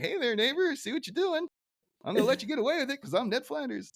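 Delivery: high sugary neighborly voice; Ned Flanders. Retold or imitated. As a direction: chirp it up like an endlessly nice neighbor. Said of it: "typical Flanders thing where he's just kinda like".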